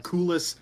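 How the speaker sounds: "kulis" in two syllables, KOO-lus; variant pronunciation. Shown as a demonstration. Fan listing pronunciations they've heard, variant "kulis" as KOO-lus. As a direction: say "kulis" with a long "oo" first. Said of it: "so many different pronunciations".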